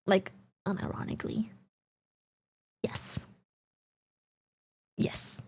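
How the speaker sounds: fast whispered aside; quick breathy whisper of an aside. Real, not acted. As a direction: hush it out quickly. Unvoiced whisper.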